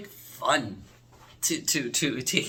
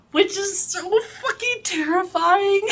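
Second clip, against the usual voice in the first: delighted shriek; 6 dB louder, slower than usual. Real, not acted. Gushing over a scary episode.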